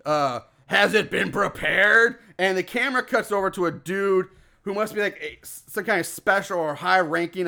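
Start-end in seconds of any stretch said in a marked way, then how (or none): 0.1-2.2 slow, drawn-out mock possessed cult leader; deeper demon voice. Possessed Corbus addressing his cultists, in a slow mock-demonic voice. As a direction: intone it like a spooky satanic figure. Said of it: "he talks in a deeper voice".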